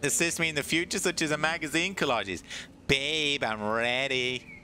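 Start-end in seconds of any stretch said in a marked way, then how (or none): 2.9-4.5 slow mock seductive.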